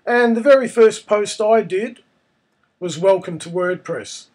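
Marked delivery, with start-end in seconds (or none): none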